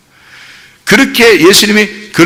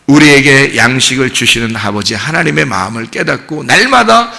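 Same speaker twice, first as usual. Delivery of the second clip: ordinary talk, nothing marked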